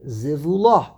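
zivula with final LA stressed; stress on last syllable. Shown as a demonstration. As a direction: land firmly on the LA of zivula. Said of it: "should go underneath the lamed".

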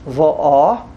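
va'ah with the weight on the final ah; va'ah, landing on the closing ah; stress on last syllable. Shown as a demonstration. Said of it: "the last syllable".

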